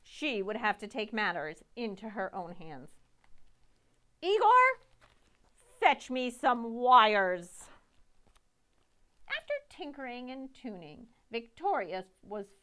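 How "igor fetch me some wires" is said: loud order. Acted. Victoria ordering Igor to fetch wires, loud and slow. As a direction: bellow the order to the assistant.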